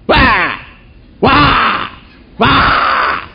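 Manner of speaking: loud, high mock shouts of "Wah"; angry yell. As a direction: shout at the tree like the village elders. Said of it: "yelling to the tree, angrily".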